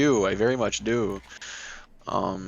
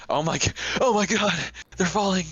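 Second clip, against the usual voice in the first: panicked studio crew; 10 semitones higher, faster than usual. Retold or imitated. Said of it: "all the people in the studio were like".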